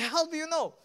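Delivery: high-pitched, incredulous outburst; astounded, going crazy. Retold or imitated. Man stunned that a stranger knows of his deaf ear, astounded. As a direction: act out a man freaking out in disbelief. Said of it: "he went crazy".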